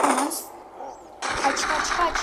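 frantic yelling to escape; panicked yell. Real, not acted. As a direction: yell at yourself to run. Ambushed in game, yelling.

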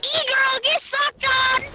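loud, high victory taunt; crowing at a beaten opponent; gloating shout. Real, not acted. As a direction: yell it high and drawn out to gloat.

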